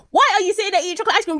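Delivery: high, fast, loud outburst; exasperated scolding. Acted. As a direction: rattle it off in outraged disbelief. Pauses, then scolds the characters directly.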